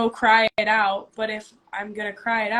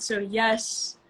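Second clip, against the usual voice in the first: ordinary talk, nothing marked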